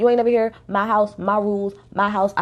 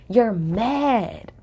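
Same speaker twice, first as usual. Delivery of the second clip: slow, drawn-out taunt near usual pitch. Real, not acted. Taunting a castmate over her printed tweets, drawn-out jab. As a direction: stretch out MAD, keeping the voice down. Taunting Cam, repeated for effect.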